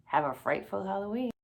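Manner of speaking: usual speaking voice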